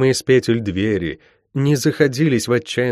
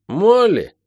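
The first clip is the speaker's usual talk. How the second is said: put-on high little boy's cry; high-pitched, fearful child's shout; frightened call; 10 semitones higher than usual. A narrator's reading. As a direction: call out like a scared younger brother. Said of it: "Испуганный голос братца".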